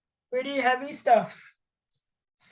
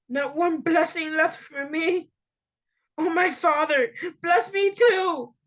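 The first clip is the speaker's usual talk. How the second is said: higher voice, tearful and begging; tearful pleading; 6 semitones higher than usual. A narrator's reading. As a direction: plead with your father through tears. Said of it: "Esau broke down and wept".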